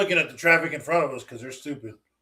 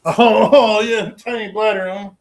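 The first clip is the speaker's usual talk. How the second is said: loud, higher, laughing jibe; teasing laugh; 6 semitones higher, 9 dB louder, slower than usual. Real, not acted. Teasing a friend over a tiny bladder, laughing. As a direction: rib them through a laugh.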